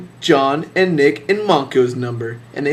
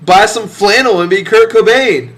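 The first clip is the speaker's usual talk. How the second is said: higher, louder mock sarcasm; sarcastic retort; 6 semitones higher, 5 dB louder than usual. Mocking a friend who idolizes [name], sarcastic. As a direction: sneer it back at him. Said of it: "I was like".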